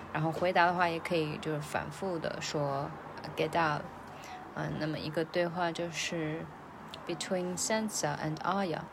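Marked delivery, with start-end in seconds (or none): none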